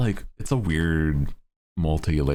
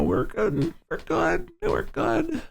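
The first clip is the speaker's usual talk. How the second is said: each take higher and squeakier; rising squeak; slower than usual. Acted. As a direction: climb higher every repeat. Repeated, rising higher each time.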